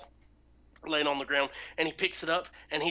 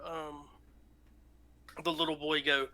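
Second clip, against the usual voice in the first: ordinary talk, nothing marked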